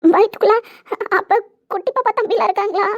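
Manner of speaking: high, loud and tearful, overjoyed; tearful joy. Acted. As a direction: cry out in happy disbelief.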